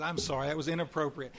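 usual speaking voice

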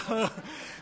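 short unvoiced laugh; breathy laugh. Real, not acted. Speaker laughing at his own smoking story, breathy. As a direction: laugh it off, just air.

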